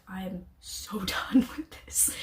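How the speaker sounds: slow, fed-up whisper with a laugh; exasperated whisper. Retold or imitated. Leaving the courtroom, fed up with the questioning, whispered. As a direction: breathe it out, totally over it. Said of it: "I was like".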